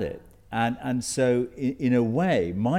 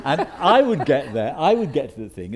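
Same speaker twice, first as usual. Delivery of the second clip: laughing; 5 semitones higher, 9 dB louder than usual. Real, not acted. Laughing retelling passport control.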